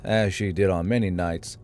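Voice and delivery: plain reading voice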